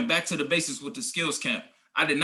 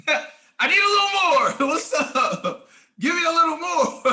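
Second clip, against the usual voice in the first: high, laughing plea for a little more; laughing, pleading for more; 11 semitones higher, 5 dB louder than usual. Retold or imitated. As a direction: beg for more through a laugh, pitched way up. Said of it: "but I really do be like".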